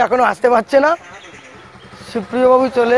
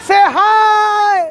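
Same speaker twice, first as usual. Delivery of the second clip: calling out; 8 semitones higher than usual. Real, not acted. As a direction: shout it to the group. Calling to arriving friends.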